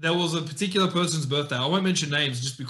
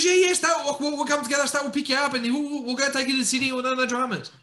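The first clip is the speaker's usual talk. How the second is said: much higher, keen imitation of the mate; mock eager Goose; 8 semitones higher than usual. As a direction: ham up the overeager friend offering a lift. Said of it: "Goose goes".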